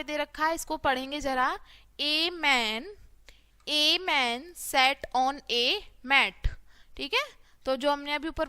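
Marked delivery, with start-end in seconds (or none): 2.0-6.5 very slow, separated words; word-by-word reading. Shown as a demonstration. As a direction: read it out slowly for beginners. Teacher models reading word by word. (धीरे-धीरे, एक-एक शब्द पढ़ना)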